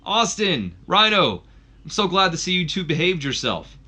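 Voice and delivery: high, loud call-out into the mic; Sting taunting Austin and Rhino from the ring; loud mocking taunt. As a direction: sneer at the two of them. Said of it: "he takes the mic and he goes".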